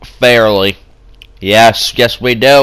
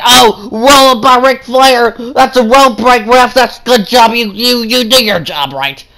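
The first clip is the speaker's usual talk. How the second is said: higher pitched excited ringside call; play-by-play; 9 semitones higher than usual. Acted. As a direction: narrate the match live. Continues match commentary.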